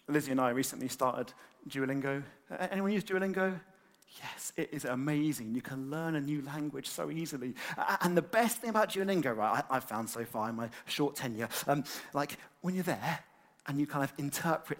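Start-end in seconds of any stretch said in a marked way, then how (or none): none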